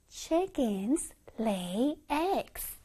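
slow follow-along reading. Shown as a demonstration. Teacher leading children through the sentence, slow and soft. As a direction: softly space out each word.

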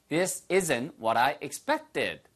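very slow, clear model sentence; slow teacher demo. Shown as a demonstration. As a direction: say it slowly so they can copy. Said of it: "your turn".

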